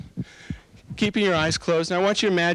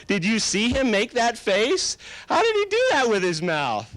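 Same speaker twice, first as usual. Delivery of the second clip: amused delight; 9 semitones higher than usual. Shown as a demonstration. Hypnotist models delight at a comedy for subjects, amused. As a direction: gush over how hilarious it is. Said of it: "So you already start to laugh".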